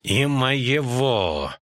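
very slow, ominous addition; slow, threatening interjection; sinister. A narrator's reading. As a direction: draw it out menacingly. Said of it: "зловеще добавил Рейнфарн".

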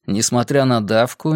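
usual speaking voice

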